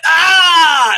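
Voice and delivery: sudden loud high shriek; battle yell. Pretend. Fan attacking the Google employee with a wild yell. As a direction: let out a wild attack cry.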